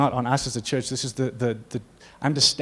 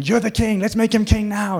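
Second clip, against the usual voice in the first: excited crowd cheer; 8 semitones higher than usual. Retold or imitated. Crowd crowning Jesus.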